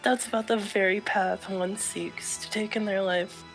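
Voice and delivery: softly measured character read; understated, soft dramatic reading; quiet game character. Acted. Reads game dialogue in character.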